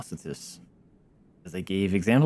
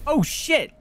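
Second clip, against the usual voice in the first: sudden high oh shit; startled shout. Real, not acted. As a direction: caught off guard, yelp it. Sudden danger in game.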